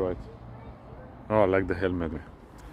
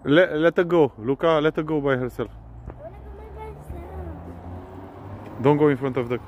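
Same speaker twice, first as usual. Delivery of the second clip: calling out; 8 semitones higher than usual. Real, not acted. Calling to kids riding.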